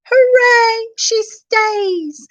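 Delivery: high, loud cheering shout. A narrator's reading. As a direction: cheer with joy. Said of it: "the dwarfs shouted".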